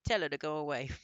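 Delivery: loud, sniffy dismissal; haughty wife. A narrator's reading. Wife telling her husband to send the old woman away, loud. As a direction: wave her off loudly. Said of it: "Missus Jenkins said".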